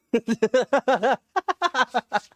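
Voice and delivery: high burst of loud laughter. Real, not acted. Laughing hard at his own crawl-space bit, loud laugh. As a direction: crack up at the bit.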